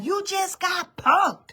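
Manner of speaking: high, drawn-out gotcha voice; spring the prank reveal; mock prank-show host; Ashton Kutcher reveal. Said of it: "Ashton Kutcher to pop out and be like".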